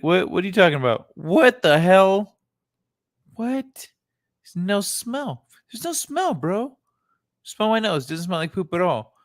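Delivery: high, protesting animal voice; indignant llama voice. Retold or imitated. Voicing llama dialogue.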